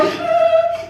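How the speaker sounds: high, sung-out excited gasp. Retold or imitated. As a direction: gasp like a thrilled lady. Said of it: "and then the ladies would go".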